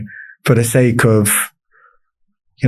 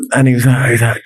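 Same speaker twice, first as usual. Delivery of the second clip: normal talking voice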